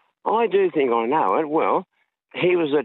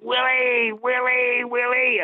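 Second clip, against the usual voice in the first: calling out loud; 11 semitones higher, slower than usual. Retold or imitated. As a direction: roep de zanger luid over de menigte. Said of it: "I was singing out".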